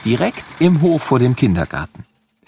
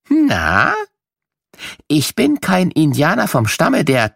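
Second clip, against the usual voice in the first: put-on bright, teasing quiz for small children, pitched up; playful riddle voice; 9 semitones higher than usual. Host inviting children to guess who he is, playful and teasing. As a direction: tease the kids with a guessing game.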